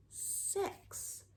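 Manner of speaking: usual speaking voice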